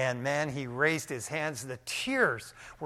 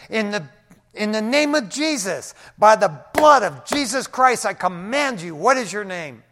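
loud, forceful command in Jesus' name; commanding rebuke; 5 semitones higher, 8 dB louder than usual. Retold or imitated. Pastor commanding the demon in Jesus' name. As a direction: command the spirit to give its name.

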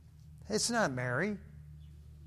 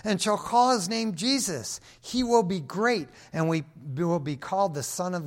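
raised, ringing scripture reading; 4 semitones higher, 6 dB louder than usual. Acted. Pastor reads Gabriel's words to the church, ringing proclamation. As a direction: proclaim the angel's words to the congregation. Preacher reading Luke aloud.

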